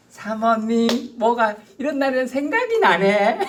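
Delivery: high, slow, sweet-talking; wheedling husband. Retold or imitated. Husband sweet-talking his wife on a rainy day, high and slow. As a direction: coax the wife with flattery. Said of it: "이러면서".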